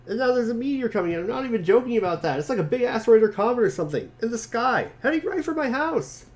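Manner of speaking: panicking about the incoming meteor; excited character voice. Acted.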